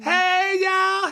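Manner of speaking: high, loud on-air greeting shout; smile in the voice. Shown as a demonstration. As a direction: belt out a big smiling radio hello. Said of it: "have a smile in your voice".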